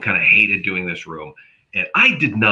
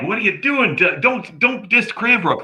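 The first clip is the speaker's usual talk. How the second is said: high pitched mock indignation; playful whine defending Cranbrook; mock outrage; 13 semitones higher than usual. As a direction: protest in a high, pained voice.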